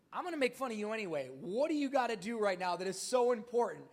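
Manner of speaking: exasperated. Retold or imitated. Pressing Sarah, exasperated.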